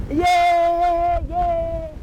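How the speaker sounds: high, loud cheering yay; wild crowd yay. Acted.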